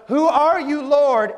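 high, loud cry to heaven; loud acted plea, pitched high; calling out. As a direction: call up to the voice in alarm, acted. Acts himself blinded, calling to heaven.

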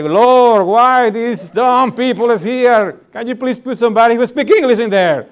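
hammed-up exasperated cry to the Lord; angry prayer. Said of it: "start getting angry and say".

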